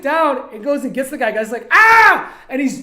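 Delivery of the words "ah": very high, very loud imitated yell; hammed-up cry of alarm; mock scream. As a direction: scream like a man being jumped. Imitates attacked man screaming.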